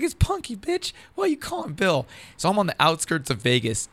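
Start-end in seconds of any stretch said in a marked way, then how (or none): 0.0-2.1 much higher put-on voice, mock outrage; mock-furious high voice on the phone; Punky Johnson on the phone. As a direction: squeal it way up high like an irate caller. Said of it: "She's like".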